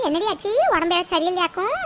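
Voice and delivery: normal talking voice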